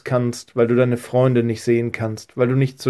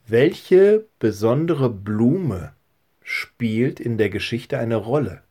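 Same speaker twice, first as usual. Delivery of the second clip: slow question; slower than usual. Shown as a demonstration. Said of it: "Und die dritte Frage ist".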